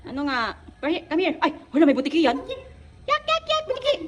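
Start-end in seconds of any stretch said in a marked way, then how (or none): 3.1-3.9 squealing very high yak yak; disgust. Real, not acted. Mother squeals at the lizard in mock disgust. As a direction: shriek out in playful disgust.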